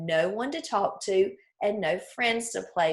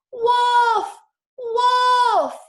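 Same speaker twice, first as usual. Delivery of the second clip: high, very loud panicked cry; a frightened boy shrieking as wolves attack; terrified yell; 9 dB louder than usual. A narrator's reading. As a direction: scream for help at the top of your voice. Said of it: "yelled at the top of his voice".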